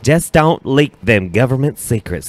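lightly put-on Southern accent. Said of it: "When you do a Southern accent".

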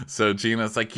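ordinary talk, nothing marked

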